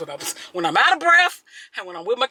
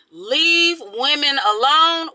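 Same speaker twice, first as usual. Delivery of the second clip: slow, higher, each word leaned on; emphatic demand; 5 semitones higher, 4 dB louder, much slower than usual. Acted. Telling men her two rules, emphatically. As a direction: lay down the rule word by word. Announcing her rule, drawn out.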